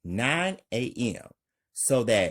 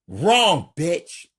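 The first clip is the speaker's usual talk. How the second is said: usual speaking voice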